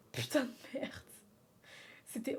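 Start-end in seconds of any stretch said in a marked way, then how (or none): 0.1-0.9 whispered under the breath; whispered curse. Real, not acted. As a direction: whisper the swear to yourself.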